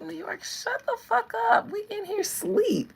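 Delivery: high, loud, exasperated plea; exasperated bystander. Acted. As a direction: complain like someone woken from sleep. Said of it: "I just be like".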